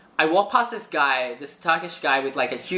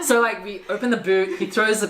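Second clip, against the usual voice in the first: ordinary talk, nothing marked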